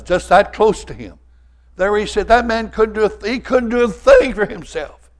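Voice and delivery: loud, high, rising fire-and-brimstone preaching; preaching at full force. Acted. Preacher warning his church about living in sin, full-force preaching. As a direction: build it up and hammer the congregation.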